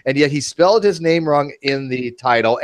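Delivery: usual speaking voice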